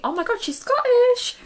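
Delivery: high, put-on Scottish voice; Scottish accent. Vlogger boasts about her accent, high mock Scottish. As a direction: act an amazed listener with Scottish accent. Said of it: "you would think".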